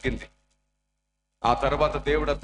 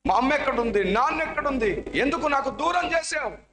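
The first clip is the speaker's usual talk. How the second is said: crying out; 11 semitones higher than usual. Acted. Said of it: "అరుస్తున్నాడు".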